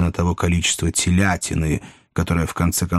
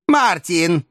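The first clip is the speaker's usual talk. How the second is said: put-on high-pitched old lady summoning; 10 semitones higher, 3 dB louder than usual. A narrator's reading. Old lady summoning coachman at the door, high voice. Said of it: "сказала старая леди".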